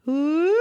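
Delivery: high, loud open whoop. Shown as a demonstration. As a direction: let a free whoop fly up. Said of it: "you can whoop".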